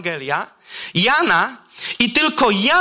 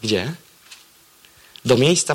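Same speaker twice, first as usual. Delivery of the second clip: usual speaking voice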